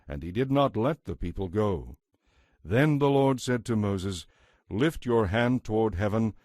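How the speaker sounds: normal talking voice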